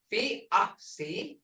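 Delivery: slow fi-a-si-ki-i; syllable by syllable. Shown as a demonstration. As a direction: spell fi-a-si-ki-i out slowly. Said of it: "you don't hear the C very much".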